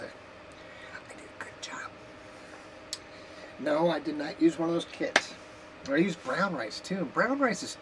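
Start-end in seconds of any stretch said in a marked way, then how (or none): 0.0-1.9 whispered self-praise; whispering. Real, not acted. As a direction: whisper it proudly to yourself.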